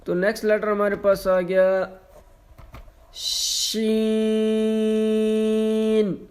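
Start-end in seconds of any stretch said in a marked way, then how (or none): 3.1-6.2 شین held two seconds with pressure; pressed, long شین; pressed long Sheen. Shown as a demonstration. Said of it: "دبا کر پڑھنا ہے اور دو سیکنڈ لمبا".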